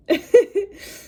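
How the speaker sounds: high, loud laughing burst; burst of laughter. Real, not acted. Reader laughs at viewers over the sacrifice card, loud laugh.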